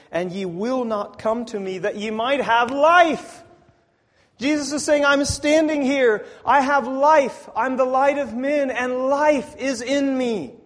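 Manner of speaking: high, loud, ringing proclamation; full-force preaching. Acted. Preacher voicing Jesus' claim to have life, full-force preaching. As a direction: proclaim it loud and high, drawing out key words.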